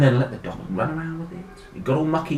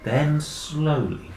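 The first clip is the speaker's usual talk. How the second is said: usual speaking voice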